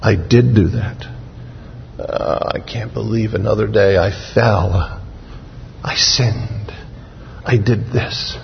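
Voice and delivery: soft, near-whispered confession of sin; hushed remorse. Pretend. Sinner waking up, quietly confessing to God, hushed remorse. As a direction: murmur it like a man ashamed at dawn.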